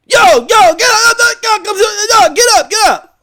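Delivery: high, loud, frantic wife impression; shouted, high-pitched rousing; urgent wake-up shout. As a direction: imitate a wife yelling someone out of bed. Wife waking him up.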